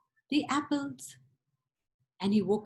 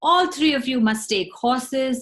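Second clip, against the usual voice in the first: summoning king; 3 semitones higher, 8 dB louder than usual. Acted. King summons three sons.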